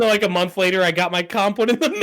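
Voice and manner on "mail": ordinary delivery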